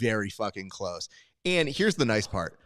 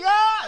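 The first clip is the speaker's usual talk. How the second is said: high, loud shriek; imitated scream; 9 dB louder than usual. Contestant screaming at the man beside her, loud mock scream. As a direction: screech like an overwhelmed contestant. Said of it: "she's, like, screaming at me".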